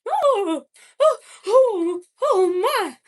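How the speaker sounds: high, loud mid-Atlantic put-on; mid-Atlantic accent. Shown as a demonstration. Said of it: "What should I say in a mid-Atlantic accent? I can do it".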